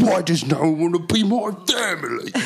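whiny bear voice. Pretend. Mock Paddington voice.

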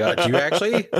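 high, loud, laughing mock shock; mock surprise. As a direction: act amazed through a laugh. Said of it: "I was like".

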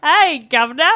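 cheeky cockney hello; British accent. Pretend. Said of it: "weird British accent".

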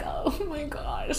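soft, slow, high dismay; dismayed sigh. Retold or imitated. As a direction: breathe it out, overwhelmed. Retelling her dismay.

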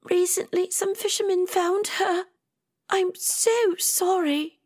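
very high-pitched tearful character voice; weeping. A narrator's reading. Rosie telling Atia of a death and apologising, weeping. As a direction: deliver a high, weepy apology. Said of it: "as she wept into a handkerchief".

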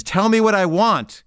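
acted-out demand, voice rising; insistent demand. Insistent demand repeated, escalating.

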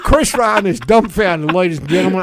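loud, mumbling, mouth full of marbles; Mitch McConnell, marble-mouthed. Acted. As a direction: talk like your cheeks are stuffed with marbles. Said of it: "Was that English?".

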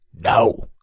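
loud booming "No!". A narrator's reading. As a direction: boom it out. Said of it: "His voice boomed again".

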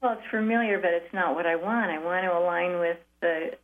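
ordinary talk

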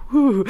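loud high shuddering whew; creeped-out exhale. Real, not acted. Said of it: "I'm getting the heebie-jeebies".

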